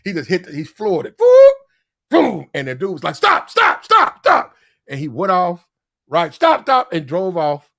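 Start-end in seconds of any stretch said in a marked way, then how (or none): 1.2-2.5 loud vocal vroom, pitched up; imitated engine roar. Storyteller mimics dude flooring the car, loud engine boom. 3.2-4.5 high, loud mock guard's yell; retold urgent shout. Guard to drunk driver fleeing the gate, urgent acted shout. 6.3-6.9 quick, loud imitation of a guard's yell; retold urgent shout. Guard as the car drove off, acted urgent shout.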